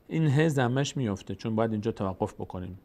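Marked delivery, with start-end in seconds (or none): none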